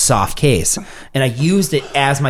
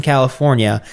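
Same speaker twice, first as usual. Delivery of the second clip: ordinary talk, nothing marked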